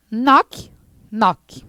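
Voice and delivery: knock, knock: drop the K, just nok; silent K. Shown as a demonstration. Teacher showing pupils that the K in knock is silent. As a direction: knock said nok, no K sound at all. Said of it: "Se fala assim, ó".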